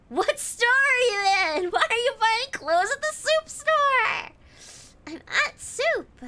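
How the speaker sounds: hammed-up high meme voice; meme reenactment. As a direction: act out the confused shopper sketch. Acting out the soup meme dialogue.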